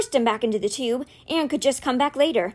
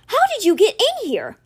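high, loud, outraged demand; shocked, furious questioning; explosive outburst; 11 semitones higher, 5 dB louder than usual. A narrator's reading. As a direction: explode at the intruder. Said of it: "Alex exploded".

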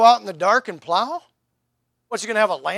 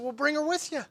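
higher and softer mock-helpful quip; sly comeback; 7 semitones higher, 7 dB quieter than usual. Preacher imagining his reply to the excuse, higher and softer. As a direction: toss it off as a sly suggestion. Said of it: "I said".

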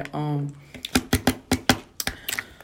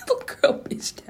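laughing; 3 dB quieter than usual. Real, not acted. Repeating own punchline laughing.

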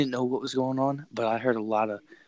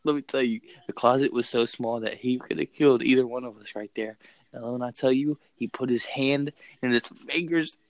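ordinary delivery, nothing marked

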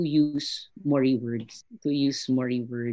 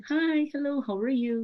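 1 pleasant Kiwi greeting; 10 semitones higher, slower than usual. Retold or imitated. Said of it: "Very pleasant".

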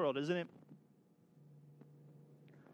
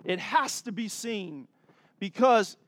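louder, rising preacher's call; full-force preaching; 4 semitones higher, 6 dB louder than usual. Acted. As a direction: drive it home to the congregation. Preaching builds to climax.

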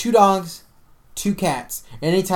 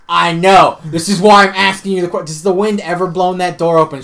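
louder, pressing mock panic; urgent plea; 3 semitones higher, 9 dB louder than usual. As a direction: push the question hard and fast.

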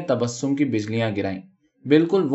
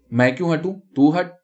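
higher, raised-voice squabbling; indignant, pitched-up comeback; defiant retort; 4 semitones higher, 3 dB louder than usual. A narrator's reading. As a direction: push back louder on the last words. Acted quarrel between co-wives.

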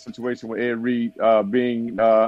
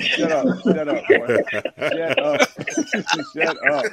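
helpless laughter between the words; laughing retort; 11 semitones higher than usual. Real, not acted. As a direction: tell a teasing friend off while cracking up. Teased about girlfriend, laughs it off.

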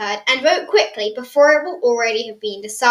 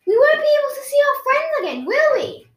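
distressed question; 14 semitones higher, 6 dB louder, faster than usual. Acted. Realising she can't see friends.

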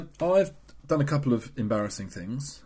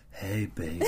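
mock seductive purr, low and soft; 5 semitones lower, 5 dB quieter than usual. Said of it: "sexiest voice in the world".

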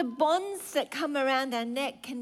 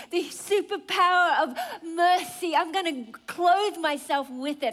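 fervent swell; 5 semitones higher, 4 dB louder than usual. Real, not acted. Rising fervent declaration.

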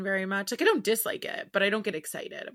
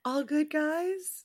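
slow, higher, awkward "All good, guys?"; awkward TikTok voice; 7 semitones higher, much slower than usual. Retold or imitated. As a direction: imitate an awkward person checking in. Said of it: "TikTok sound that's like".

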